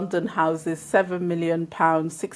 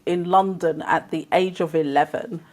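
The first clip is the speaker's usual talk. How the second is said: ordinary delivery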